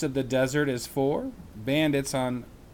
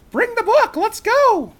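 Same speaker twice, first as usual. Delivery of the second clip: high and loud, bursting with excitement; a girl urging her brother to hurry; eager Annie; 10 dB louder than usual. Acted.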